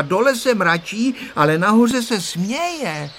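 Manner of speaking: high, insistent explaining; resolute voice. A narrator's reading. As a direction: settle the argument with high-pitched certainty. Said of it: "řekla rozhodným hlasem Tereska".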